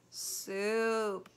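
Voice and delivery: longing, drawn-out moan. A narrator's reading. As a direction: ache for it. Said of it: "moaned Roscaro".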